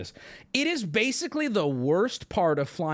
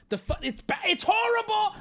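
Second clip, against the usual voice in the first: sputtering into a high-pitched outburst; stammer, then break high in mock horror; reenacted panic. Passenger answering the calm pilot, high panicked protest. Reliving terror of helicopter ride.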